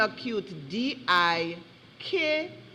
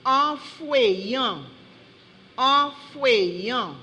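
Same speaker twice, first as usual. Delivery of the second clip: slow, drawn-out syllables; measured spelling bee call-out; slow word dictation; 2 semitones higher, slower than usual. Real, not acted. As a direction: announce the word carefully to the speller.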